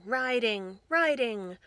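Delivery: ordinary delivery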